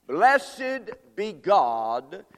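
very slow, loud, stretched out; drawn-out proclamation. Acted. Preacher lifting up the verse to the church, very slow and loud. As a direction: let each word ring.

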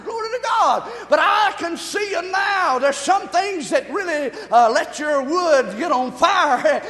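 high, loud praise preaching; fervent pulpit shout; full-force preaching. Real, not acted.

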